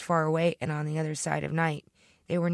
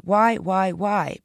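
loud, insistent squeal; louder, pleading repeated whys; squealed protest; 3 semitones higher, 7 dB louder, much slower than usual. A narrator's reading. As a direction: squeal out each why. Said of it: "Tovey squealed".

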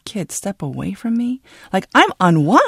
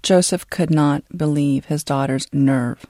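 ordinary delivery

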